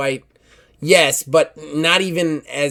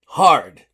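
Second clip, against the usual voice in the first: a loud, blunt single word "Hard."; blunt boast; 8 dB louder than usual. Acted. Warrior woman flexing to the flimsy man, loud blunt boast. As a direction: flex and state it, punched out. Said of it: "She goes".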